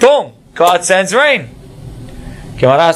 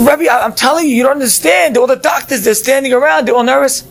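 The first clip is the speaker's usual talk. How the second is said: much higher, breathless panic; frantic; 10 semitones higher than usual. Acted. Student bursts in to the Rebbe, frantic high panic. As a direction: burst in desperate and rushed.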